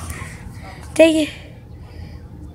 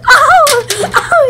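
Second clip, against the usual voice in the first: laughing shout; 7 semitones higher than usual. Real, not acted. Sees gun, laughs and shouts.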